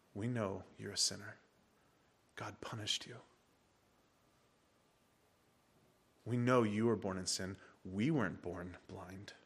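soft, low, venomous sneer. Retold or imitated. Pharisees sneering at the healed blind man, soft venom. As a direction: hiss contempt quietly. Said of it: "Can you hear the venom?".

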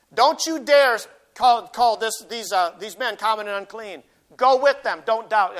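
loud, emphatic order from the Spirit; stern divine command. Acted. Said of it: "the Holy Spirit is intervening, intervening and saying".

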